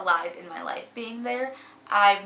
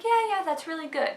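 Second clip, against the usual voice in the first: higher, bright mock approval; mock eager praise; 8 semitones higher than usual. Imitating her group praising her reading, higher mock approval. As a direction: chirp it like a proud cheerleader.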